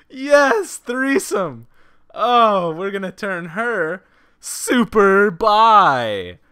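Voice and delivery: loud, high gleeful cheering, slow at the end. Real, not acted. Player gleefully joking about Jenny, loud excited cheer. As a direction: cheer it like you just won something.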